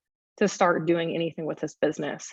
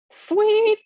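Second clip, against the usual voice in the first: excited; 7 dB louder than usual. Real, not acted. Screen share finally works.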